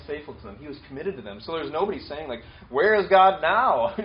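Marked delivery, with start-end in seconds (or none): 2.7-3.9 drawn out and loud, a hammed-up doubting cry; loud mock complaint. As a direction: call it out slowly like a mock accuser. People complaining God has left.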